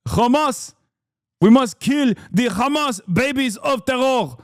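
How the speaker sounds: high-pitched put-on Middle Eastern accent; mock regional accent. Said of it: "Middle Eastern regional dialect".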